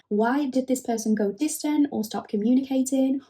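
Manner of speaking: ordinary talk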